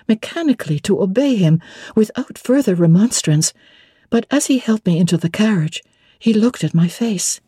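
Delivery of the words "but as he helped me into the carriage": ordinary delivery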